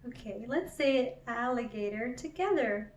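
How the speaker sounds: ordinary delivery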